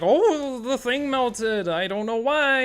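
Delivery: high, loud and slow mock dim voice; hammed-up dopey complainer; clueless customer parody. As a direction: imitate a baffled buyer who left it on. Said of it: "It's like".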